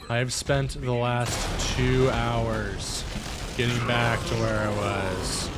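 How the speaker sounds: loud, slow exasperated rant. Real, not acted. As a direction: grind out the frustration. Frustrated after dying.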